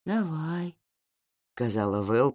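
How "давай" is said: put-on higher drowsy child voice; barely awake, sleepy agreement; nodding off. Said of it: "проснувшись только наполовину". (сквозь сон, детский голос)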